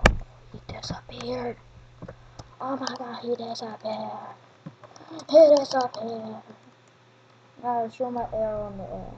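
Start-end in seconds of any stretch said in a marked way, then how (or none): 0.1-4.3 breathy whisper of surprise; hushed whisper. Real, not acted. Kid whispering to himself as a player vanishes. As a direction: whisper it like you just saw someone vanish.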